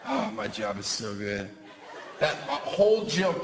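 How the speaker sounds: normal talking voice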